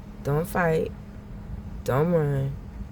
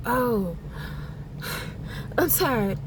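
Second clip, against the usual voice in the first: mock tired sigh, higher pitch; faked tiredness; 5 semitones higher, 5 dB quieter, slower than usual. Imitating a woman faking exhaustion to the mother. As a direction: fake being worn out with a sigh. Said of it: "she gonna fake like".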